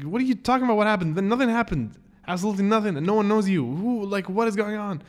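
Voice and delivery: high-pitched, incredulous protest; exasperated disbelief. Retold or imitated. Retold quarrel with Jack.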